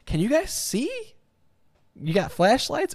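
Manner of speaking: lightly voiced lax inspector waving things through; mock lazy inspector. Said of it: "You're like".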